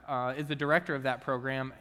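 ordinary talk, nothing marked

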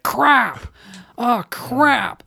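high-pitched imitated outburst; Mike really upset. Imitating Mike having one of his spouts, really upset. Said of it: "really upset".

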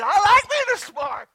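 high, loud retold outburst; excited acted reply; delighted exclamation. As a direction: burst out with it gleefully. Retells own delighted reply.

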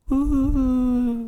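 high, airy twinkly ooh; magic sound. Retold or imitated. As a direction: coo it like a sparkle of magic. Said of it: "it goes".

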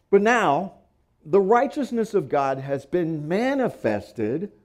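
preacher reading aloud, stressing key syllables; emphatic scripture reading. Acted. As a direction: read the passage to the congregation with weight.

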